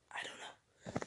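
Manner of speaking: barely audible, whispered admission; real whisper to oneself; whispered aside. Real, not acted. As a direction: give up under your breath. Gives up recalling a name.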